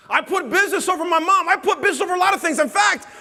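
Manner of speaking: high, loud preacher's shout; preaching at full force. Acted. Motivational speaker shouting his confession to the crowd. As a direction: fire up the crowd like a revival preacher.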